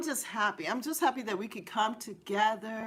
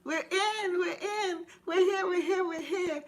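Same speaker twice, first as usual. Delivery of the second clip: high, bubbly chant of arrival; excited; 7 semitones higher than usual. Real, not acted. Host celebrating joining the live stream, high and bubbly. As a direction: sing out the welcome. Greeting viewers, overjoyed.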